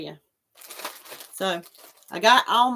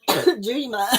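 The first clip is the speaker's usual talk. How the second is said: coughing. Real, not acted. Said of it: "I choked on my water".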